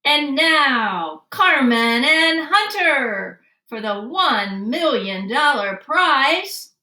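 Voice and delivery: hammy game show announcer; game show host. Acted. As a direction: slowly build up the big prize reveal. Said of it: "Like a game show host".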